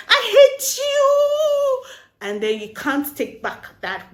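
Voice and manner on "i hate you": very high, drawn-out mock yell; hammed-up, long-held quarrel scream; angry outburst. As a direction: wail it loudly, stretching the last word. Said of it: "talking when we're angry".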